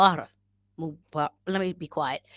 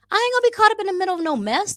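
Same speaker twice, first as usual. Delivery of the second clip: sassy high voice; 13 semitones higher, 6 dB louder, faster than usual. Pretend. Sassy declaration.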